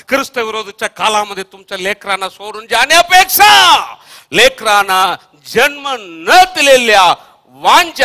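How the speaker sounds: loud preaching swelling to a high shout; impassioned warning. Real, not acted. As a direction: build to full force and hammer it home. Preacher builds a warning to parents.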